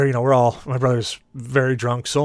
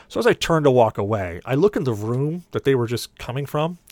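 normal talking voice

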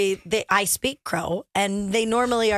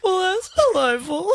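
fancy lady voice; 6 semitones higher than usual. Retold or imitated. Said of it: "And then you're like".

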